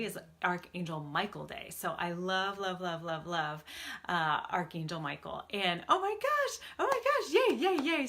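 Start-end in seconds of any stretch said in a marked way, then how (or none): 5.9-8.1 much higher, louder squealing oh my gosh; excited squeal. Real, not acted. Host greeting her viewers, squealing with delight.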